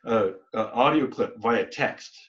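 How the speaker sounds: normal talking voice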